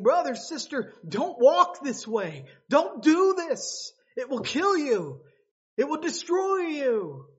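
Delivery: a brother warning family away from ruin; urgent plea. Acted. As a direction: beg them to stop. Urging sinners to turn back.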